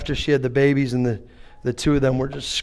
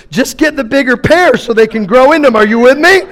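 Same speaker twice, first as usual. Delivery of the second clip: bossy advice; 10 semitones higher, 10 dB louder than usual. Retold or imitated. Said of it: "I was like".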